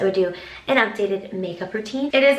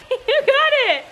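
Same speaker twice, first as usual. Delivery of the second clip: excited; 12 semitones higher, slower than usual. Real, not acted. Cheering the Roomba pong shot.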